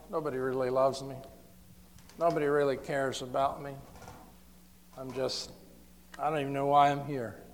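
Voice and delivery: quick, soft, hopeless inner voice; lonely thought. Retold or imitated. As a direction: murmur it like a lonely heart.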